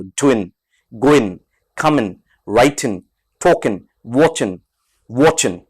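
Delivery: गोइन, कमिन, वॉचिन ending on n with no g; dropped g in ing. Shown as a demonstration. Teacher shows students ing without the g. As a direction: end on a clean n, no g. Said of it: "ग की आवाज नहीं बोलनी है आपको".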